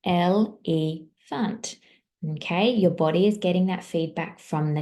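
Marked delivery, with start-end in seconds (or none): 0.1-1.8 EL, EE, FUNT in three slow beats, rising at the end; drawn-out Elephant with gaps between syllables; split into syllables. Shown as a demonstration. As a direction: sound out Elephant very slowly, syllable by syllable. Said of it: "It had three syllables".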